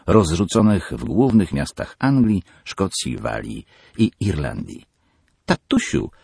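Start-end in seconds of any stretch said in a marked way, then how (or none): 5.5-6.1 very high whiny call of daddy; a spoiled girl stamping her foot; stamping daughter. Acted. Peggy stamps and calls her father in a whine. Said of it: "tupnęła Peggy".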